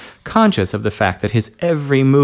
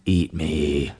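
low, gruff husky order; husky gruff command; 7 semitones lower, 5 dB quieter than usual. Acted. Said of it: "Ed's voice had been husky and gruff".

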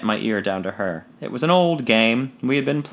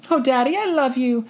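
put-on high girlish gush of love; enthusing daughter; 12 semitones higher than usual. A narrator's reading. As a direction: gush to her father. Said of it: "she enthused".

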